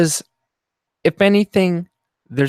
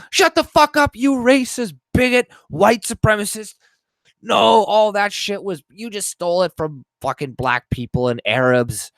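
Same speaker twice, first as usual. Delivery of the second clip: mock furious rant; 3 semitones higher than usual. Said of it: "or something like that".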